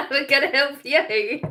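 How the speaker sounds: real laughter spilling over slow words; cracking up. Real, not acted. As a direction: laugh hard all through the line. Cracking up at the begging.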